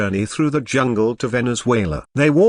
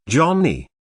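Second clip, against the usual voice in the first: calling out a little higher, a mild yell; 5 semitones higher than usual. A narrator's reading. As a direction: call for Johnny in alarm, lightly voiced. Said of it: "yelled Ploopy".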